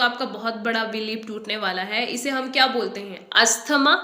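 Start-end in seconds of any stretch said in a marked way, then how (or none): 3.3-3.9 "अस्थमा" as us-thuh-ma, rising; wrong pronunciation. Shown as a demonstration. Teacher testing the common error, rising us-thuh-ma. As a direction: say "अस्थमा" with three syllables and th, questioning. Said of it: "ना अस्थमा बोलना है".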